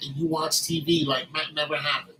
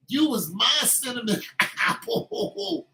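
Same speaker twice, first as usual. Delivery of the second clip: mock sweet pet name; 5 semitones higher, slower than usual.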